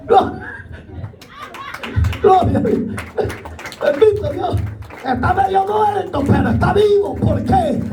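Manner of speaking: high, fervent altar call over music; full-force preaching. Acted. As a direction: plead with sinners to come to Jesus.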